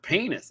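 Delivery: "penis" said as PAY-niss, pitched high; high, playful "penis", rhyming its start with pain; comic pronunciation. Shown as a demonstration. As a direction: savor "penis" with a long AY in the first syllable. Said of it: "You gotta say it with a little".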